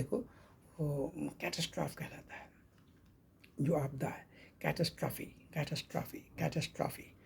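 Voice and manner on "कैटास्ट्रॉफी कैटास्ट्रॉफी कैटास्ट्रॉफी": कैटास्ट्रॉफी with final ee, whispered and soft; correct pronunciation repeated. Shown as a demonstration. Teacher drilling कैटास्ट्रॉफी softly for students. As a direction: repeat कैटास्ट्रॉफी quietly, stressing the ending ee. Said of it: "ना कि कैटास्ट्रॉफ".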